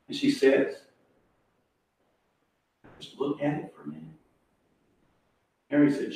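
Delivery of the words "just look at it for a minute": very soft, barely voiced plea; hushed awe. Agnes cannot bring herself to cut cake, imitated hushed plea. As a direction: imitate an overwhelmed woman asking timidly. Stunned Agnes asks timidly.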